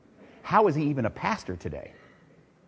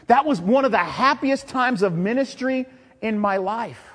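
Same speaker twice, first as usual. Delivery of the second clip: loud, high preaching voice; 11 semitones higher, 6 dB louder than usual. Acted. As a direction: proclaim it to the congregation. Preaching on the psalm.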